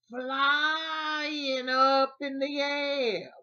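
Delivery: big, slow storyteller's whoop; drawn-out exclamation. Retold or imitated. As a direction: stretch FLYING out long, high and loud. Dramatizes sister's flight in story.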